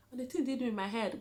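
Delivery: ordinary talk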